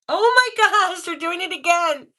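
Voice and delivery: high, loud, thrilled outburst; overjoyed disbelief; excited amazement. Real, not acted. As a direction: exclaim in real astonishment, pitch leaping up. Device goes off again.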